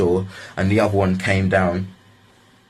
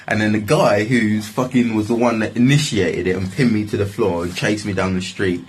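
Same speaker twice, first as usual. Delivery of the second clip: ordinary talk, nothing marked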